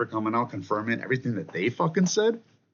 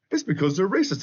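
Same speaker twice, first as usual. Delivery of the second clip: higher, sarcastic repeat of the excuse; mocking whine; 8 semitones higher than usual. Ranting vlogger mimics Democrats crying racism, sarcastic higher sneer. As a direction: parrot their excuse back with a sneer.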